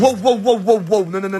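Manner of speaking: loud, repeated wows to cut him off; urgent "whoa, whoa" protest; alarmed objection. Acted. Friend stops him crossing a line.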